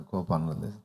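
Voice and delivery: low, gentle and over-serene; hammed-up calm of a reformed man; lightly put-on serenity; mock saintly patience. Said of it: "బాగా దీర్ఘశాంతంతో".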